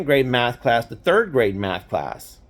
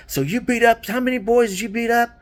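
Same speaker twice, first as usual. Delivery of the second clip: higher, eager dad pumping his son for the story; proud excited dad; 10 semitones higher, faster than usual. Retold or imitated. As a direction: imitate a thrilled father, pitched up. Dad proud, slapping my shoulder.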